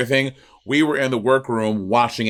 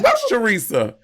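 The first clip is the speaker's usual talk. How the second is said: mock quote; 12 semitones higher than usual. Repeating the funny quote.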